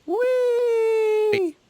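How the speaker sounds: very high gleeful shout; excited squeal. Pretend. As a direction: squeal it like a kid on a slide. Pun shouted over Wii talk.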